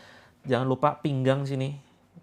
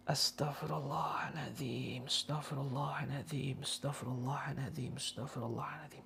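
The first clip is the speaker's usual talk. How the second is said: breathy exhale; 15 dB quieter than usual. Shown as a demonstration. Healer showing patients how to exhale istighfar in a whisper. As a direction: breathe the prayer out through the mouth. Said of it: "keluarkan lewat mulut sambil istighfar".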